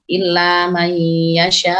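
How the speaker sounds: "illa may yasha" recited with a long held end and firm stop; strong final hamzah. Shown as a demonstration. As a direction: hold the last vowel of "yasha", then press the hamzah. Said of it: "hamzahnya nggak boleh lemah".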